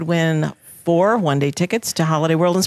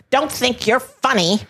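put-on higher, fussy old-lady scold; hammed-up old lady; 7 semitones higher than usual. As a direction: play up the nagging old lady. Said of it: "Boy, do I sound like an old lady".

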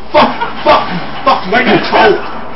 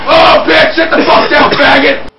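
loud, higher-pitched taunt; gloating shout; 4 semitones higher, 7 dB louder than usual. Real, not acted. Gamer loudly taunting an opponent he just killed. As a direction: bark the taunt right after the kill.

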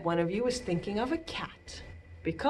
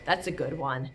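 ordinary delivery

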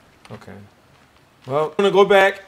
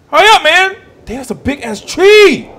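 excited yell; 12 semitones higher, 9 dB louder than usual. Real, not acted. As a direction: shout at the game to hurry. Chopping tree, urging it on.

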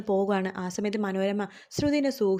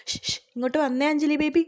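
higher-pitched beckoning with a shush; shush, then call her over in a raised pitch; 6 semitones higher than usual. Retold or imitated. Manorama summons Anjali baby aside, beckoning call. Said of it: "Manorama വിളിക്കുകയാണ്".

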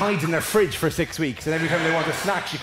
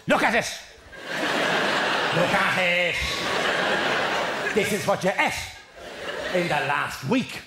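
mock scolding TV host, in separate bursts; lightly imitated accusing nag, pausing between phrases; Gillian. Host confronting someone with their food table, mock scolding. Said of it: "Gillian points to the table and goes".

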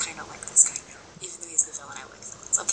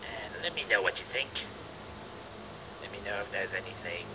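a much lower, goofy put-on voice, dropping to a whisper at the end; silly low voice; 10 semitones lower, 6 dB quieter, faster than usual. Reading lines in a put-on silly voice, much lower. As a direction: play a silly character voice, sinking lower. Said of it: "I'm still doing that silly voice".

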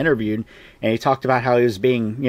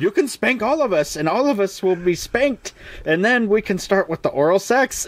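much higher hammed-up girlish voice; eager maidens; 7 semitones higher than usual. Maidens teasing Galahad, hammed-up high. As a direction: coo it like a chorus of eager maidens. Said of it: "they're like".

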